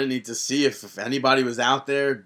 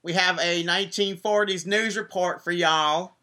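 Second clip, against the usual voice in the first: higher, louder Southern-tinged newsreader voice; Dixie Carter impression; 5 semitones higher, 3 dB louder than usual. Host slips into Dixie Carter reading the news to listeners. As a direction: play a folksy Southern news anchor, y'all and all. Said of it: "Just go into Dixie".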